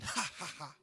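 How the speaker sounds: low, soft put-on laugh; gloating mock laugh. Envious churchgoer sees another fail, gloating fake laugh. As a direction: laugh quietly at someone's failure.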